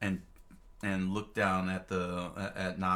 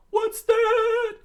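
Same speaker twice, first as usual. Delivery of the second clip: very high, loud cartoon squeal; Sanji's startled squeal; 12 dB louder than usual. A narrator's reading. Narrator voices Sanji hearing something in the jungle, startled squeal. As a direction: squeak it out at full volume, stretched.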